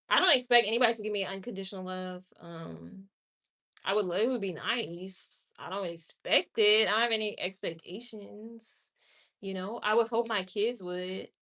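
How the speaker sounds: ordinary talk, nothing marked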